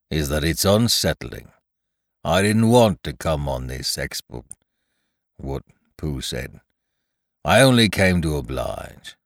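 low, ponderous donkey voice; Eeyore, gloomy grumbler. Acted.

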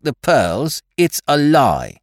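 high, slow, heated protest; heated denial. A narrator's reading. The French maid denying theft, heated and high. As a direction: fling the denial back hotly. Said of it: "she declared heatedly".

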